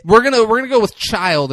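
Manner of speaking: loud, high insistence; firm. Real, not acted. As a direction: put your foot down loudly. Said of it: "correcting friend".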